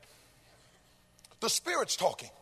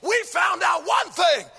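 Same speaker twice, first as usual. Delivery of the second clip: loud rhythmic whooped sermon over the organ; full-force preaching; 3 semitones higher, 6 dB louder than usual. Acted. Preacher to his congregation, louder, half-sung full-force whooping. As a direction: ride the rhythm, preach it out to the rafters.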